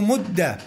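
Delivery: "مد" ending in "a", mudda; fatha ending. Shown as a demonstration. Teacher giving students the imperative, "مد" with fatha. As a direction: say "مد" with doubled d and short "a". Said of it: "نفس هذه الأحكام تجري في الأمر".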